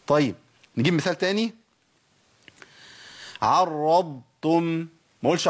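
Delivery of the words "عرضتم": عرضتم very slow, long ض, then a separate تم; separated Dad and Ta. Shown as a demonstration. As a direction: hold the ض in عرضتم before releasing تم. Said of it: "تفصلوا بين الضاد والتاء".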